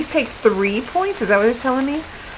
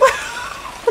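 breathless laugh; 5 dB quieter than usual. Real, not acted. Breathy laugh after disgust.

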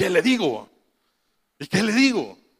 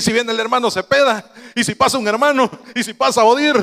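raised, louder fretting; retold panic; 6 semitones higher, 4 dB louder than usual. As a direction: worry aloud in a mock fluster. Retells his panic as mother cried.

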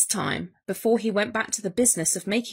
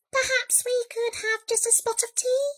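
high, polite mouse voice; little mouse; 12 semitones higher than usual. A narrator's reading. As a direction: squeak a hopeful, dainty request. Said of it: "said the Mouse".

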